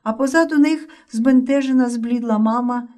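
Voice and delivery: plain reading voice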